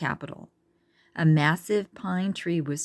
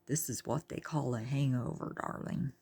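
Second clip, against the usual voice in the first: soft low murmur, trailing to a whisper; 3 semitones lower, 9 dB quieter than usual. A narrator's reading. Tor murmuring to Charlene Darling about the hyenas, soft murmur. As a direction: lean in and murmur it. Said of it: "he murmured to Charlene Darling".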